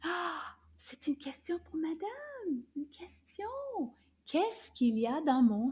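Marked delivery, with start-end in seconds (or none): 0.0-3.5 hushed and high, like sharing a secret; secretive whisper. Acted. Teacher whispering a secret to the kids, high and hushed. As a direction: whisper it so madame can't hear.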